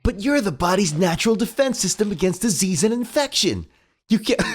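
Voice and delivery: high heroic cartoon hedgehog; earnest hero pep talk; Sonic voice. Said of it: "quoted straight from the comic".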